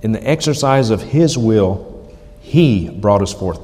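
HIS and He hit emphatically, with a pause between; stress on His, He. Shown as a demonstration. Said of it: "notice the emphasis there".

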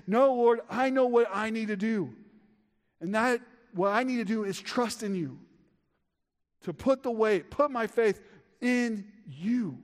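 fervent prayer. Acted. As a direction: plead it out loud like David.

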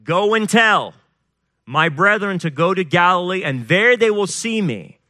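scripture proclamation. A narrator's reading. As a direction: declare it as a command to the congregation. Reads scripture, stressing the command.

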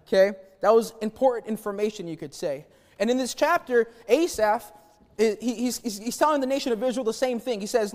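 ordinary talk

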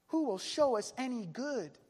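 crying out. Retold or imitated. Many doubting companions asking for good, crying out. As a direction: call the question out to everyone. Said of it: "Many are saying".

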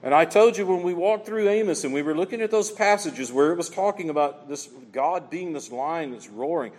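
usual speaking voice

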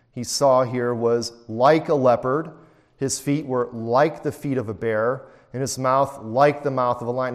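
each "like" lifted and leaned on; stress on like. Shown as a demonstration. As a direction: hit every "like" in the verse. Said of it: "Now I'm emphasizing that word "like".

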